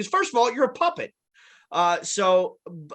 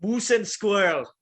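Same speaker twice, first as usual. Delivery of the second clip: Boris impression; 2 semitones higher than usual. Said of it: "as smart and conniving as Boris".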